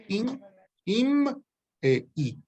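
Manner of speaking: "In, im e i" said high and very slowly, each form separated; listing three forms. Shown as a demonstration. Teacher to students, slowly naming the prefix alomorphs. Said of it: "este son tres alomorfos".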